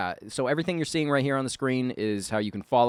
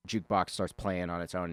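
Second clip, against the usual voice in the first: normal talking voice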